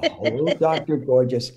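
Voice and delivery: brisker repeat of a fan's flirty hello; lightly imitated fan greeting. Retelling the fan's greeting to the actor, lively light imitation.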